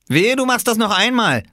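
high, loud warning; threatening. A narrator's reading. Bigger boy warning the little one, loud threat. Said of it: "drohte er".

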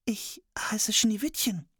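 put-on slow, whispered girl voice; shy whisper. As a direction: answer timidly in a whisper.

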